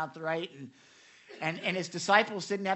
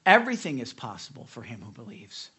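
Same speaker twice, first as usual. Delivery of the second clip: ordinary delivery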